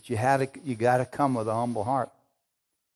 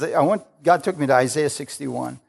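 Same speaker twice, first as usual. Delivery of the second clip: ordinary talk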